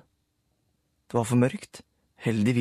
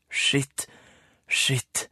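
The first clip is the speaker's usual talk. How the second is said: Rulle swearing; 3 semitones higher than usual. A narrator's reading. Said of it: "sa Rulle".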